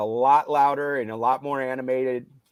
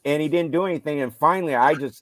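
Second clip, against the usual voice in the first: normal talking voice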